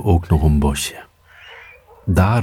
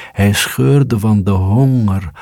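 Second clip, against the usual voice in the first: ordinary delivery, nothing marked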